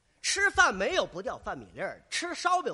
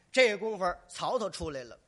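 ordinary talk

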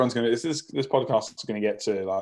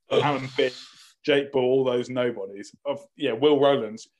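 ordinary delivery

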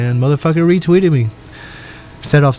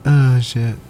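ordinary talk, nothing marked

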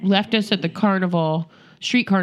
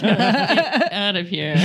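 ordinary delivery

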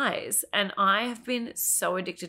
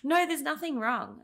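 higher, breezy mock dismissal; mocking doctors; 9 semitones higher, 3 dB louder than usual. Patient mimicking doctors brushing her off, high mock.

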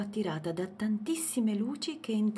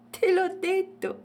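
high, loud, tickled little elephant; amused reply; 13 semitones higher, 8 dB louder than usual. A narrator's reading. Elephant reminds Matilde of his dream, amused. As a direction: answer with playful amusement. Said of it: "rispose Agostino divertito".

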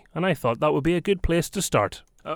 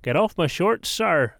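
mock Belfast accent; 6 semitones higher, 6 dB louder, slower than usual. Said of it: "in your best possible accent".

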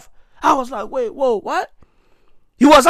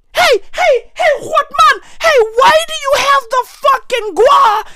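high, cracking yell at the money planters; yelling at a man for burying his cash; mock outraged yelling; 14 semitones higher than usual. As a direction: shriek it like you caught them at it.

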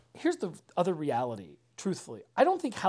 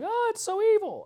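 very high, loud panicked wail; mock scream; 5 dB louder than usual. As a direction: shriek it in hammed-up horror. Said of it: "screaming, yelling".

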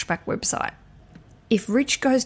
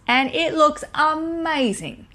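slow, much higher gush of admiration; 8 semitones higher, slower than usual. Real, not acted. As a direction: swoon over the dress. Admiring bride's dress photo.

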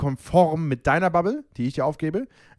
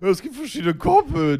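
mocking; 8 semitones higher, 4 dB louder than usual. Mocking partner's earlier explanation.

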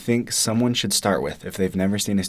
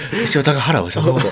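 fake Japanese; 8 semitones higher, 7 dB louder, much faster than usual. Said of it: "You just made that up".

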